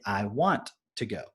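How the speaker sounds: very slow, carefully spelled-out "He wants to go"; slow teaching demo; model sentence. Shown as a demonstration. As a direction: teach it word by word. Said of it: "But we would say".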